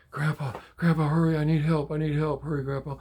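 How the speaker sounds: high, loud, urgent cry for help; urgent plea. Retold or imitated. Granddaughter phones grandpa for help, urgent plea. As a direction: imitate a panicked granddaughter calling.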